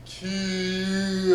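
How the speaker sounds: long, drawn-out slow-motion yell; slow-motion kiai. Shown as a demonstration. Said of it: "got the slow motion kia too".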